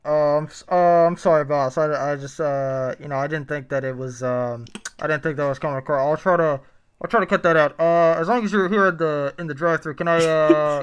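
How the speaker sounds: nervous fast food employee talking to boss; drive thru speaker. Acted. Plays employee Michael.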